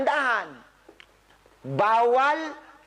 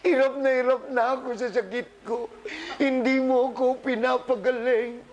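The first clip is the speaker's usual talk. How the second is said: put-on whiny, pleading complaint; hammed-up tearful prayer; mock tearful plea; 3 semitones higher than usual. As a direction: imitate a patient crying to the Lord. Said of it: "umiiyak na kayo kay Lord".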